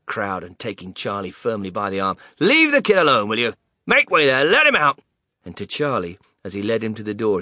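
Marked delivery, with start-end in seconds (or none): 2.4-5.0 firm shouting. Acted. Shopkeeper clearing the crowd.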